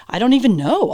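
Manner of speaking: loud, high burst of disbelief; amazed exclamation. Retold or imitated. Answering tearful fans, a loud amazed burst. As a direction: blurt it out, stunned and thrilled.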